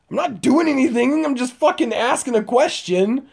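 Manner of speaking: high-pitched retold self-defence; indignant protest. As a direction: protest your innocence, high and exasperated. Retells protesting his innocence.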